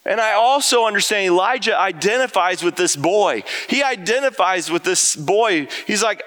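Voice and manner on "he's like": ordinary talk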